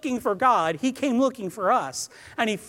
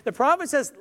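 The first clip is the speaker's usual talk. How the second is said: normal talking voice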